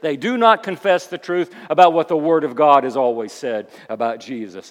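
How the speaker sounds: loud, insistent sermon with punched key words; emphatic preacher in full flow; forceful preaching. Acted. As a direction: hammer each point from the pulpit.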